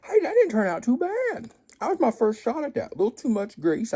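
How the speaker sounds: light up at the first taste; pleasantly surprised by his own hash brown cake; pleased surprise. Real, not acted. Pleased after tasting.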